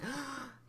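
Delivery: high, sudden intake of breath; shocked gasp. Retold or imitated. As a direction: gasp in acted panic. Retold shock at being touched.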